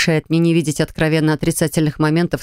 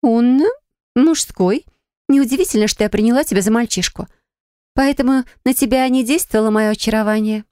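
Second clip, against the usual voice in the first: lightly voiced pouty young woman, higher; spoiled girl voice; 5 semitones higher than usual. Spoiled girl explains herself.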